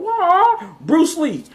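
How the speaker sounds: very high, drawn-out martial arts wail; mock kung-fu cry. Johnny Cage launching into a fight, mock kung-fu cry. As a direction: shriek like a Bruce Lee fight call, hammed up. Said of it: "he goes into the fight stage".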